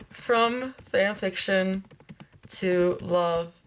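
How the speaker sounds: title spelled out slowly word by word; slow, deliberate reading. Real, not acted. Streamer rereading a fanfiction title to herself, slow and deliberate. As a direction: take "From fanfiction to love" slowly, with pauses.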